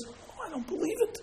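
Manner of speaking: high, soft, awed gasp of words; stunned disbelief. Acted. Summoned man recognising the prime minister, astonished. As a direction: breathe it out high in amazement.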